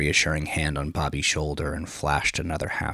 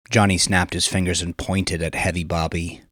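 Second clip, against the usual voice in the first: ordinary delivery, nothing marked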